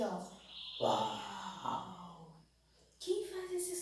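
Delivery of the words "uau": low growling lion roar, lower. Shown as a demonstration. Teacher imitating the lion's sound for children, low roar. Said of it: "Ele faz esse som aqui".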